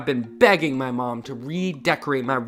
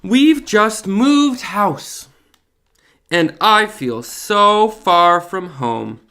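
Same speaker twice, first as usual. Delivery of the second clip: slow, louder reading-aloud voice; story narration; 2 semitones higher, 5 dB louder, slower than usual. A narrator's reading. Dad reading the new book to kids, slow and clear. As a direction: read it slowly to children.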